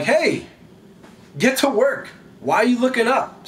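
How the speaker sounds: high-pitched, urgent telling-off; scolding. Acted. Angels to followers staring at heaven, scolding.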